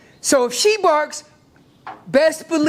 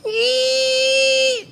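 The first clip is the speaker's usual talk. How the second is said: long high squealing cow call; high squeal like a cow elk bugle; 13 semitones higher than usual. Retold or imitated. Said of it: "she sits there".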